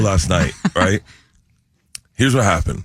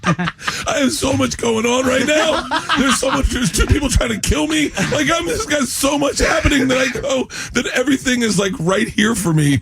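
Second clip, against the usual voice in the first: laughing. Real, not acted. Laughing through overwhelmed rant.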